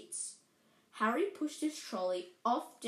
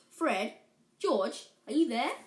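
louder call through the open door; 6 dB louder than usual. Acted. Mother calling her sons from the platform, loud. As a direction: call for the boys from outside.

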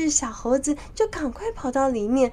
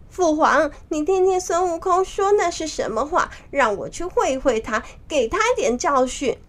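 higher-pitched, mildly angry plea; 6 semitones higher than usual. Acted. Nezha asks his father to fight, mildly angry. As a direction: demand a chance to fight, a touch of anger. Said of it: "他非常的生气".

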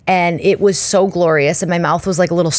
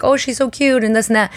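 much higher cooing voice; cooing nurse; 6 semitones higher than usual. Retold or imitated. Nurse in the hallway cooing over the baby, higher.

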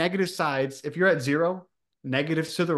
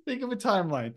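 laughing; 5 semitones higher, 4 dB quieter, faster than usual. Real, not acted. Laughing through the explanation.

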